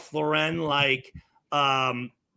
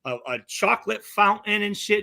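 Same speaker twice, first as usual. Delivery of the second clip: ordinary delivery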